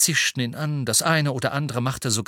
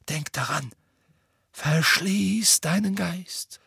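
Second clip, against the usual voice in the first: slow, insistent whisper; urgent whisper; 7 semitones higher, slower than usual. A narrator's reading. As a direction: whisper it as a secret last warning. Dumbledore's secret last warning to Harry.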